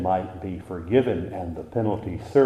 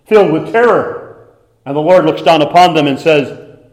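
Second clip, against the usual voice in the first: rising higher and louder into a roaring climax; preaching at full force; 6 semitones higher, 12 dB louder than usual. Acted. Preacher painting the angel scene for the congregation, building to a roar. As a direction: build the terror until you are shouting it.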